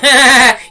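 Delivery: loud real laugh; laughing at her own joke; burst of laughter. Real, not acted.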